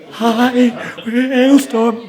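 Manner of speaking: high, loud mock-terrified stage greeting; hammed-up nervous squeal; imitating nervous shaking. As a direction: quaver it like a band with stage fright. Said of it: "we were shaking like this".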